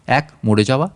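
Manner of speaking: ordinary delivery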